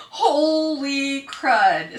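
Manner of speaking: slow, loud, drawn-out amazement; stunned exclamation. Real, not acted. Reacting to the vindicating message, slow and loud. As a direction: stretch it out in disbelief. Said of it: "own reaction to the message".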